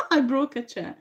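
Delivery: high-pitched, laughing admission; helpless real laughter running into the words. Real, not acted. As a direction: say it through a real burst of laughter. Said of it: "Sorry for laughing at".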